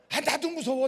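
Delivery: high, loud, hammed-up protest; frightened protest. Pastor asked to stay by the coffin, loud frightened protest. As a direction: plead loudly, overplaying the fear. Pastor voices his own fear.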